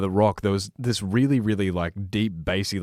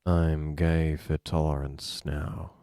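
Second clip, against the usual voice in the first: slow, lower, softer, bored line read; flat deadpan read; 7 semitones lower, 6 dB quieter, much slower than usual. Pretend. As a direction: drone it out with zero enthusiasm. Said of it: "that's too much passion".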